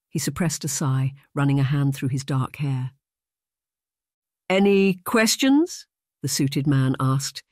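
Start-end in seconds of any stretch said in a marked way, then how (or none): 4.5-5.9 slow, higher, eager invitation; keen salesman's drawn-out question; undimmed enthusiasm. A narrator's reading. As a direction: draw it out brightly, lifting at the end. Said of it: "his enthusiasm undimmed".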